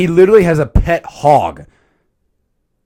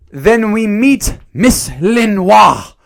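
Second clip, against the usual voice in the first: slow, loud, raised-pitch character reveal; grand introduction; 6 semitones higher, 5 dB louder, much slower than usual. Pretend. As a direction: announce her name with drawn-out drama.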